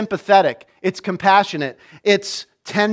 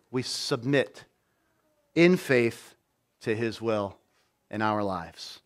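much lower and much softer, sinking to a hush; 8 semitones lower, 9 dB quieter, slower than usual. Real, not acted. A preacher urges the congregation to submit, in a hush. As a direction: let the words fall away into near silence. Sermon point trailing into hush.

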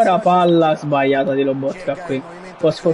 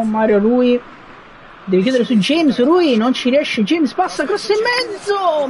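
building higher and higher; rising excited commentary; 8 semitones higher than usual. Real, not acted. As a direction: call the danger climbing in pitch. Danger in own box.

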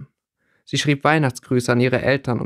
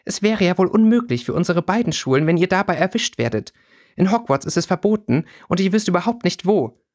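higher-pitched, exasperated scolding; 6 semitones higher than usual. A narrator's reading. Regina warning both boys about being caught, scolding. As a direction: keep lecturing them in a raised pitch. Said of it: "schimpfte Regina".

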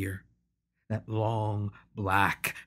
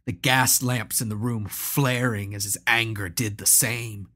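usual speaking voice

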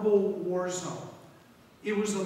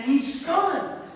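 louder, higher, ordering tone; commanding summon; 8 semitones higher, 6 dB louder, slower than usual. Acted. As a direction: bark it out like an order from the master. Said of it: "he ordered".

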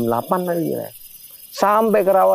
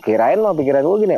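ordinary talk

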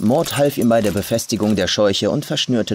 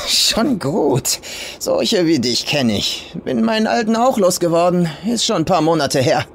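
put-on rough old farm woman; 8 semitones higher than usual. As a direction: sneer it as the crude old farmer.

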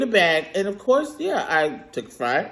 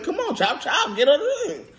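high mock-impatient prodding; impatient urging; 9 semitones higher than usual. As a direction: hurry the worker along, pitched up. Urging slow worker to hurry.

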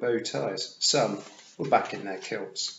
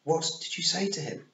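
higher, insistent question; demanding Queen; 8 semitones higher than usual. A narrator's reading. The Queen demands to know what Tiffany told Roland, high and insistent. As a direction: press her for an answer. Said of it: "Queen demanded".

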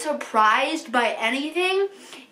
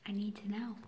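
voiceless, pleading; desperate whisper; 3 semitones lower, 15 dB quieter than usual. Real, not acted. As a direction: whisper the need to know.

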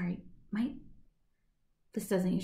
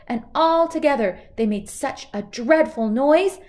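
plain reading voice

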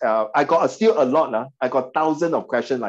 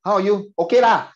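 put-on Singaporean accent; 5 semitones higher, 4 dB louder than usual. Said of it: "Usually Singapore".

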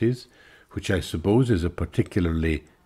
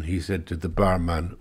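ordinary delivery

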